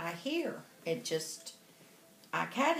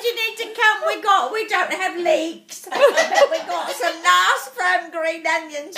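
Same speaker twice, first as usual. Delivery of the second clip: louder, higher put-on English accent; mimicked English accent; 4 semitones higher, 7 dB louder than usual. Inviting the English lady to Texas, loud English accent. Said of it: "we're talking like this the whole time".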